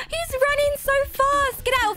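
high, rushed alarm while fleeing; panicked. Real, not acted. Gamer fleeing the fast runner, high panicked voice. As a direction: flee in a frightened babble.

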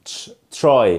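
"تش" isolated, then "try" as "chry"; "try" with a drawn-out "chr" start. Shown as a demonstration. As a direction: build "try" from a "ch" sound. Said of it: "لا بقول تش".

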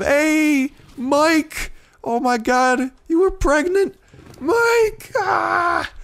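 loud, very high-pitched yelling; high excited shouting. Real, not acted. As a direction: shriek it out at the top of your range. Shouting at game creature in alarm.

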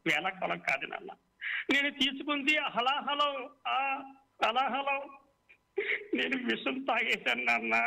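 anguished confession, rising high; pained. A narrator's reading. Son confesses to his father he drank poison, pained. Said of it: "బాధతో కూడిన మాటలతో".

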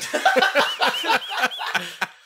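big gleeful laugh; loud laugh. Real, not acted. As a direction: burst out laughing. Laughing at his own story.

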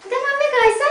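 high, sing-song coo at the puppy. Real, not acted. Owner cooing high at a tiny puppy.

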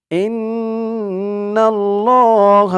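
إن الله with a slow, nasal held nn; recitation with ghunna. Shown as a demonstration. Teacher showing the ghunna in recitation. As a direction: hum the nn of إن الله. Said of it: "একটা গুন্না আছে".

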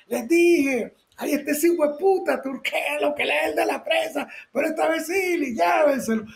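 high angry order, yelled; angry boss shouting. Retold or imitated. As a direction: shout at his men to grab the photographer. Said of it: "me vuelve a decir". (jefe ordenando a gritos)

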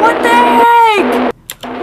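stretched-out, slow, loud cry; startled shout. Real, not acted. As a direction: howl it out in shock. Sudden jump scare.

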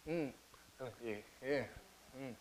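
soft grunting mm and yeah; soft imitated mumbling sounds; deaf man's noises. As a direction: mimic a man who can't speak, murmuring. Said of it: "making his noises?".

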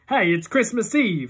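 high, slow nervous excuse; nervously smooth it over; Iggy nervously pleading with the father. A narrator's reading. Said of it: "he sounds real nervous".

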